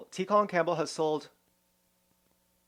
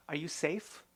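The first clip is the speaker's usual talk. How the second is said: hushed, prying mock concern; lightly voiced nosy counselor, soft and far off; mock old woman; 7 dB quieter than usual. Mimicking the old woman on the call asking if she's safe, hushed mock concern. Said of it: "She says".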